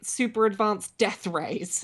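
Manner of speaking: ordinary delivery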